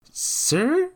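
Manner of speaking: put-on very high questioning child voice; timid boy. A narrator's reading. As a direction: approach a gentleman with a small, high question. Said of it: "Jacques timidly stepped".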